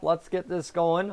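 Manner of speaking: normal talking voice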